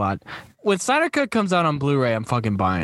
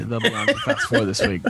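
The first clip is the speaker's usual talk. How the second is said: laughing; 4 semitones lower than usual. Real, not acted. Laughing while announcing guests.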